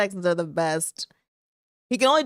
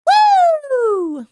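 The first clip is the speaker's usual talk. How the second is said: high, loud drawn-out "woo"; piercing party whoop; long whooping cheer; 9 dB louder than usual. Real, not acted. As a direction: whoop at the top of your voice. Cheering about Sunday's games.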